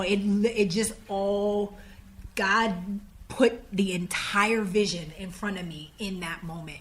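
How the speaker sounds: usual speaking voice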